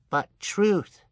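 usual speaking voice

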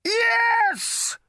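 high triumphant yell; triumphant shout. A narrator's reading. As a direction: shout it like a victory. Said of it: "hissed Agrajag".

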